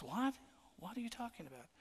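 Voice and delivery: a high startled "What?" that settles into puzzled asking; playing the wife, caught off guard; disbelieving. Wife answering her husband at the graves, in disbelief. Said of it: "In disbelief".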